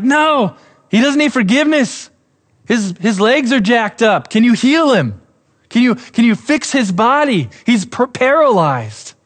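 high, pleading cry for healing; imitating desperate friends, high and urgent. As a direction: beg him to fix the man, voice pitched high. Onlookers on roof pleading with Jesus.

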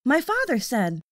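usual speaking voice

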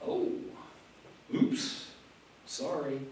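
small, mock-sheepish oops; sarcastic apology. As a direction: apologize without meaning it, softly and in pieces. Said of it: "a little bit of sarcasm in his voice".

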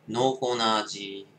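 talking while smoking; words around a cigarette; cigarette at lips. Real, not acted. Seen 0: Cigarette held at lips from 2.5s, not during first half.

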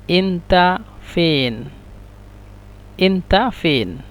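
এন্তা ফেন drawn out slowly, word by word; slow teaching repeat. Shown as a demonstration. Teacher modelling the dialect question for students, slow. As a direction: say এন্তা ফেন slowly so learners can copy. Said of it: "আঞ্চলিক ভাষা এন্তা ফেন".

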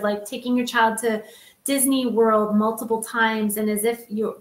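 ordinary talk

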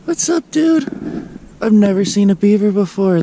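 ordinary delivery

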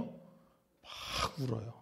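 slow, soft, hushed crying tone, fading to a whisper; soft tearful hush. Shown as a demonstration. Preacher voicing the daughters-in-law weeping, hushed. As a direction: let the words sink into a weeping hush. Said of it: "어떻게 반응해요".

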